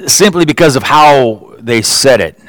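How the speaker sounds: ordinary talk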